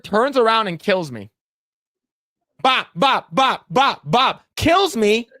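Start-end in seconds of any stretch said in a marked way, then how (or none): none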